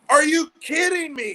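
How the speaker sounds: high, loud, laughing disbelief; incredulous. Retold or imitated. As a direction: laugh out an outraged question. Retold reaction to coach.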